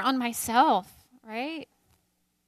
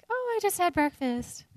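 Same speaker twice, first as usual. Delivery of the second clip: higher put-on voice quoting her husband on the phone; imitating husband; 7 semitones higher than usual. Wife quoting her husband's call, lightly imitating. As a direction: lightly play the husband from Calgary. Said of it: "So he's like".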